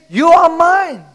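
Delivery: high, emphatic preaching cry; ringing sermon declaration; loud proclamation. Acted. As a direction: claim them in God's voice. Preacher proclaims God's words.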